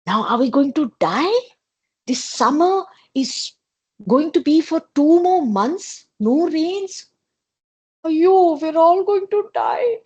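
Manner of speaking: high, rising panic; worried animals. A narrator's reading. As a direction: animals fearing they will die of thirst. Animals fear no water.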